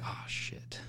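breathless whispered curse under his breath; whispered dread. Retold or imitated. Muttering to himself at the elevator, whispered. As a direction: whisper it in dismay, barely voiced. Dreading lifting body back in.

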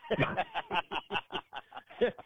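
big high laugh. Retold or imitated. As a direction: crack up laughing. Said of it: "he cracked up laughing".

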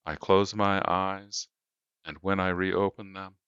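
plain reading voice

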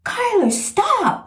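high urgent yell of stop; hollering. Retold or imitated. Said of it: "he's out there hollering at Kyler".

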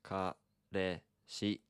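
Kareshi said very slowly syllable by syllable; slow model. Shown as a demonstration. Teacher modelling Kareshi slowly after a stumble. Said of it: "Ka-, ka".

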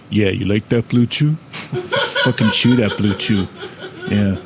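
a mock macho seducer, half laughing; sexy voice. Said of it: "if my sexy voice is like".

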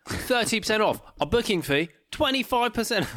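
high-pitched advert announcer send-up; mock sales pitch. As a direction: hype the discount, pitched well up. Mimicking a misleading deal advert.

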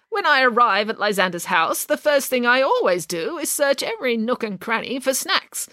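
a hint of haughty old relative; lightly voiced great-aunt. Aunt confesses in story.

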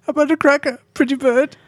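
put-on high, silly old man voice; squeaky doddering old man; Sultan voice. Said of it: "Why do you sound weird?".

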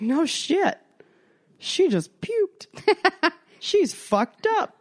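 high, slow astonished exclaim with a laugh; shocked disbelief. Retold or imitated. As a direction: gasp it out, stunned. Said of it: "I was like".

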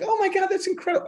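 very high squealing mock gush; imitating gushing wife. Wife gushing at the electrician's claims, squealing mock excitement. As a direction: squeal it like a starstruck fan. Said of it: "my wife's like".

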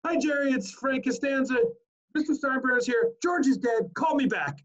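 high-pitched sitcom dad leaving a message; Frank Costanza. Acted. Sitcom dad on Jerry's answering machine, high comic impression. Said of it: "he calls Jerry and says, on the answering machine".

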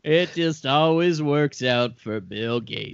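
low, slow smug billionaire; smug villain. Acted. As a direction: gloat in a low voice. Bill Gates gloating.

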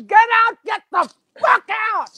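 angry wife yelling him out the door; shouting wife. Acted. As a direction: throw him out at full volume. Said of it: "The wife says".

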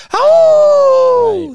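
high, loud howling cry; wolf howl. Pretend. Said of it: "like, morning radio show".

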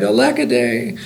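high, mildly acted lament; gentle mock wail; plaintive cry. A narrator's reading. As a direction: lament it like a storybook hero. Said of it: "he cried".

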